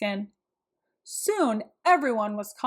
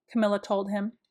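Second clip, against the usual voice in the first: ordinary delivery, nothing marked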